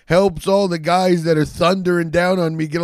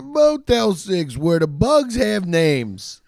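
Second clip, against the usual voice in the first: clickbait video narrator; 3 semitones higher, slower than usual. Acted. Mock ad slogan, slow.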